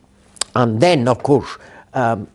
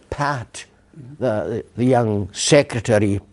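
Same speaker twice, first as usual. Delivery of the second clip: ordinary talk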